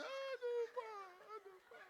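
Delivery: very high, loud comic wail; hammed-up sobbing lament; mock crying. Preacher imitating himself weeping with the pastor's family, mock crying. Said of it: "Ya, sama-sama nangis".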